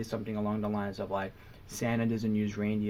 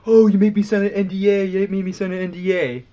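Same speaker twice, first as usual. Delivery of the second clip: lightly voiced complaining friend, higher and louder; mock Josh whining; 7 semitones higher, 9 dB louder than usual. Mimicking Josh's nagging about the NDA, mock whine. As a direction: tease his repeated NDA complaint. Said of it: "Josh kept saying to me".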